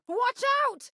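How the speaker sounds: sharp high warning call; warning cry. Acted. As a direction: yell it to warn a crewmate of danger.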